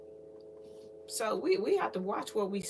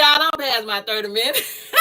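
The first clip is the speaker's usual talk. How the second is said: ordinary talk, nothing marked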